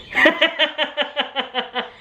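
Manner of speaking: high-pitched chuckle; real laugh. Real, not acted. Storyteller amused by the interviewer's reaction, real high laugh. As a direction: laugh at your own story.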